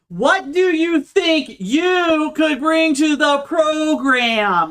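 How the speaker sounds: high, loud, drawn-out mock shout; retold yelling. Interview panel yelling questions at the candidate, high stretched-out shout. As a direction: stretch it out in a high-pitched yell. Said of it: "They're yelling at me".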